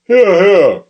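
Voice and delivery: high, loud cry of approval; cheering listener. Acted. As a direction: shout approval from the crowd. Audience member cheering.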